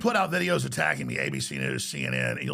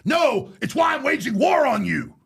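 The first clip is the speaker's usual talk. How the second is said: louder, higher war-cry declaration; defiant shout; 6 semitones higher, 3 dB louder than usual. Real, not acted. He warns his persecutors, real defiant shouting. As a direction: hurl it at your enemies.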